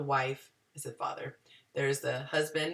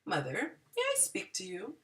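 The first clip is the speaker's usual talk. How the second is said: put-on polite child; 3 semitones higher, 8 dB quieter than usual. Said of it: "What you can say is".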